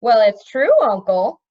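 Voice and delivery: put-on loud, insistent boy; insisting. A narrator's reading. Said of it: "the Crown Prince insisted".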